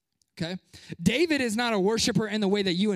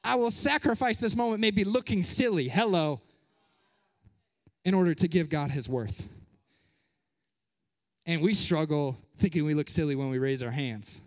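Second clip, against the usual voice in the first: usual speaking voice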